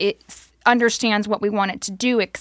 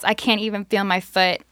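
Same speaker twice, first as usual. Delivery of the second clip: ordinary delivery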